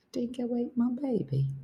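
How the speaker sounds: soft, high-pitched mock-Australian; attempted Australian accent. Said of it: "how to sound Australian".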